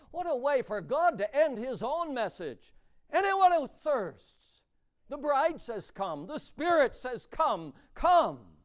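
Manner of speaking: loud, soaring pulpit voice; preaching at full force. Acted. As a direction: proclaim the invitation with full force. Preaching the closing verses.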